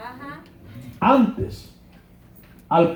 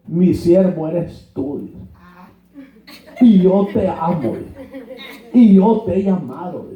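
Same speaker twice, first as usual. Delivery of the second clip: mock divine voice; slower than usual. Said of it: "¿Y qué les dijo?".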